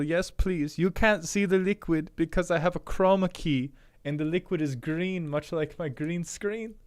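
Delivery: high, hammed-up, vaguely European accent; Magic Brian accent. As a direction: play a dainty European-accented undead scientist. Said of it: "vaguely European T M".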